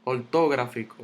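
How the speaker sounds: ortográfico stressed on TO, or-TÓ-grafico; wrong early stress. Shown as a demonstration. Teacher rejecting a wrong version, ortográfico stressed on TO. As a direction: lift TO in ortográfico. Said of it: "tampoco digo eso".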